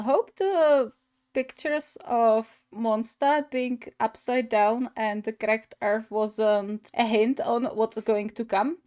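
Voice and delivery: ordinary talk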